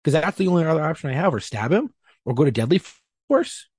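high-pitched heated rant; retold angry rant. Cop yelling back at supervisors about options, heated and high. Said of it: "And I was getting angry".

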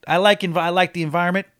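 usual speaking voice

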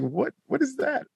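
high squeaky disbelief; surprised. Retold or imitated. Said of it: "and I'm like".

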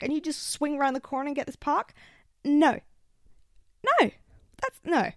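high, loud, fired-up replay of the telling-off; wound-up outraged rant; indignant rant. Retold or imitated. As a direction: let rip at the parking thief. Retold confrontation, replaying her rant.

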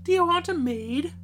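put-on high, loud timid-girl question; a girl asking at the door for work. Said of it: "says she".

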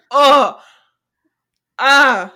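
loud, high gasped oh, ah; loud shocked gasp. Retold or imitated. Host reliving the smirk scene with a loud, high gasp. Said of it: "And I was like".

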